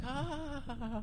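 very high, soft breaking "ah"; mock moaning scream. Showing the hosts how she moans, high mock scream. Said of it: "she's apparently a screamer in bed".

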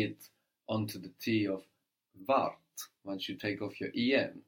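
normal talking voice

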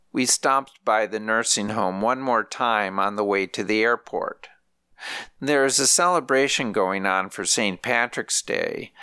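usual speaking voice

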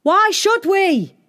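high, drawn-out challenging shout; shouted back. A narrator's reading. Villagers answer the princess, shouting back. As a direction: holler it back slowly as a crowd. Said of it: "they shouted back".

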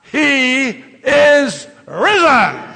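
slow, loud he is risen; loud proclamation. Shown as a demonstration. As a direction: proclaim it to the world. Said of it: "Let's say it together".

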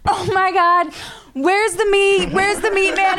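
high-pitched mock panic; frantic dad. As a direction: act out dad searching in a fluster. Dad runs outside panicking, retold.

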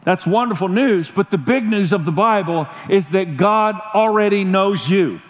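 ordinary talk, nothing marked

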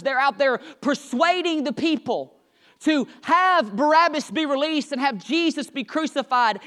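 usual speaking voice

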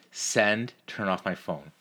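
ordinary talk

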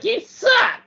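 put-on high squeaky kid yelling; little kid shout. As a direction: shriek it like a bratty child. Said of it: "the little kid was like".

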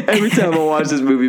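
tearful. Real, not acted. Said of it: "I'm honestly tearing up right now".

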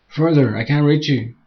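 high, loud strained cry; straining to hold on. Acted. Woman straining to reach his hand, strained cry. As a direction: call out through the effort of hanging on. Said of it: "she said, struggling to hold on".

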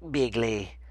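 low, soft Trump voice; a hint of Trump; Trump impression. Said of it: "sound bites of Trump going".

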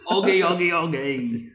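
high, loud Cockney voice; hammy Cockney Giuseppe; Cockney accent. Retold or imitated. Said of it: "Make him very Cockney".

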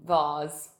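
ordinary talk

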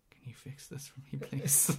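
whispering, soft and pleading; whispered plea. Pretend. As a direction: breathe the request out in a whisper. Client begging a tattoo artist.

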